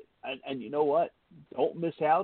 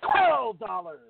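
hyped announcer; 3 dB louder, slower than usual. Acted. Hyping the ticket price.

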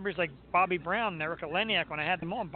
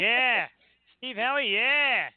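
mock careless yeah; 6 semitones higher, 5 dB louder, much slower than usual. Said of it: "I listened to that again".

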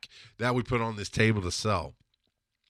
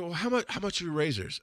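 lightly voiced customer, higher, casual; a shopper asking the price, higher; 7 semitones higher, faster than usual. Yard sale shopper asks the seller the price, lightly voiced higher.

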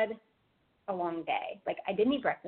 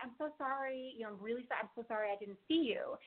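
softer, gentle sorry; soft apology; 3 semitones higher, 9 dB quieter than usual. Retold or imitated. A driver apologizing softly to the angry woman. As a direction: say it quietly, apologizing. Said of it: "I just said".

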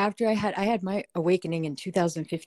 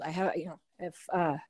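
stage fright stumbling, trailing quiet; nervously losing the thread; 8 dB quieter than usual. Real, not acted. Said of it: "I'm nervous".

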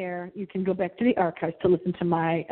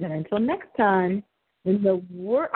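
ordinary talk

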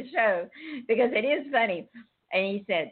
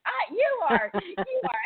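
mock whimpering submission; 13 semitones higher than usual. Friend playing along in role-play.

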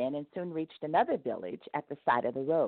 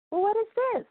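1 God questioning; 4 dB louder than usual. A narrator's reading. God questions the wagon load.

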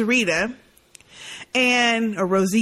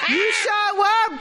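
laughing tease; 11 semitones higher, slower than usual. Real, not acted. Teasing Daryl's confession.

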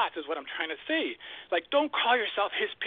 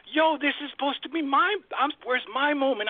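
indignant complaint; 7 semitones higher than usual. Retold or imitated. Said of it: "I'm looking around and I'm like".

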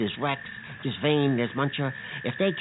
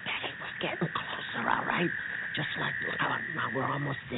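softer hushed whisper; 3 semitones lower, 6 dB quieter than usual. Acted. Bucky creeping toward the yard with Betty, whispering. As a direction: keep it down, creeping closer. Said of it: "we gotta be real quiet now".

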